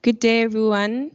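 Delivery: ordinary talk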